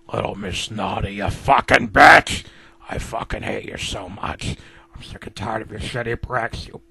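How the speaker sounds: a hint of a menacing villain; seething hatred. Letter insulting Little Miss Naughty.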